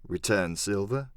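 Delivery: ordinary delivery, nothing marked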